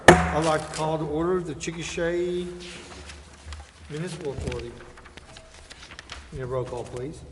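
ordinary talk, nothing marked

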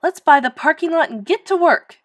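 high, loud rallying call; elated, let's-go announcement; excited cry. A narrator's reading. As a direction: cry it out triumphantly. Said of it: "cried Miss Severs".

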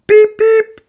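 high loud beep beep; imitated engine horn. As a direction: toot like a train.

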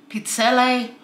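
pizzelle ending in a sounded "ay"; pronunciation variant. Shown as a demonstration. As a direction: say pizzelle as "peet-SELL-ay". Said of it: "however you pronounce it".

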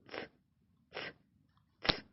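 short soft Ц bursts; repeated whispery "ts"; hushing sound. Shown as a demonstration. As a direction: hush the children with quiet ts-ts. Calls for silence.

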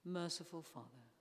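ordinary talk

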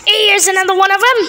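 high, quick accusing yell. A narrator's reading. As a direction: yell out the discovery, fast and high. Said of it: "I heard her yelling".

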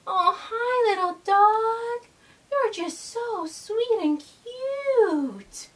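high, slow coo to a puppy; cooing affection. Pretend. Host greets a cute little dog, cooing affection. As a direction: gush tenderly, stretching it out high.

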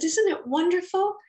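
high, loud overjoyed asking; thrilled mother's question; delighted question. Retold or imitated. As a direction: share the joy out loud. Mother thrilled over photos.

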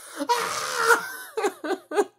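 high breathless laughing fit; helpless high cackling laughter; real laughter. Real, not acted. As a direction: lose it completely. Cracks up at own joke.

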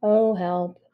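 low, deadpan cry for help; bored, toneless delivery; flatly. Acted. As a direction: plead with no feeling whatsoever. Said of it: "said the cat flatly".

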